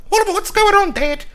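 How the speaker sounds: put-on high squeaky little kid voice; startled child asking his dad; small kid. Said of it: "Little kids stop like".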